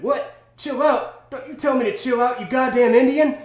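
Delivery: high, indignant quote of an angry man. Retold or imitated. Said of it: "And he said".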